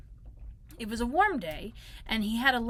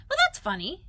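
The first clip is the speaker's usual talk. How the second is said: high, louder small-creature voice; puzzled Piglet; 10 semitones higher, 8 dB louder than usual. Acted. Piglet looks about after the bang, high and puzzled. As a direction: wonder aloud, high and baffled.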